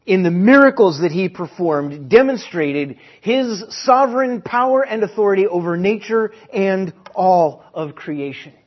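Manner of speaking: rising, forceful sermon delivery; preaching at full force. Real, not acted. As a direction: build it up like a preacher.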